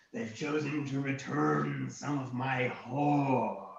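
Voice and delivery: slow, drawn-out dragon. Acted. Dragon gloating over returned gold, dragon voice. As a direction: savour each word as the dragon. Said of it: "and he said".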